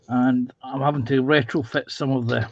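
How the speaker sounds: ordinary delivery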